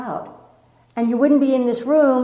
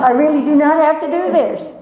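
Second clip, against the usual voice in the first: higher and louder, insisting on "not"; emphatic inner voice; 6 semitones higher, 5 dB louder than usual. Retold or imitated. On the high dive, an emphatic loud refusal. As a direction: push the refusal hard. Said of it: "a part of me that said".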